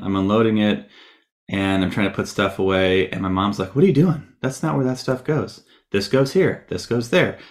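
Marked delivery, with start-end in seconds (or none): 3.8-7.4 higher-pitched imitation of an exasperated mother; raised-pitch mock maternal scolding; scolding mom. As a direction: mimic a mom correcting her kid.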